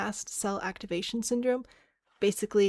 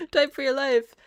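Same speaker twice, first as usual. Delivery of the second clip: loud, higher mock-urgent cry; urgent shout; 5 semitones higher, 10 dB louder than usual. Streamer recounting a typing game, urgent mock shout. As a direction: call it out like a game command.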